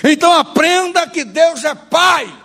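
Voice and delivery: high, ringing pulpit exhortation; emphatic preaching. Real, not acted. Preacher urging the church to learn God is Father, emphatic. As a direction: proclaim it firmly to the congregation.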